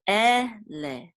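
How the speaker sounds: Ele drawn out in two parts, "eh... leh"; syllable by syllable. Shown as a demonstration. Teacher sounding out Ele for Hebrew learners, syllable by syllable. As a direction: sound out Ele slowly, one syllable at a time.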